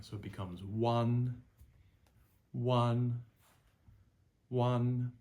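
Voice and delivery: "one" held high and level, loud and steady; first tone drill. Shown as a demonstration. Teacher drilling first tone, high level pitch. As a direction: sing "one" out on a flat high note. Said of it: "add the U in front".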